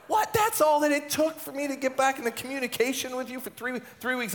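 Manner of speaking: very high, squeaky-pitched disbelief; incredulous outburst. Acted. Husband astonished that asking wife was enough, incredulous. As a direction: burst out high in exaggerated astonishment. Acted astonishment at wife's easy agreement.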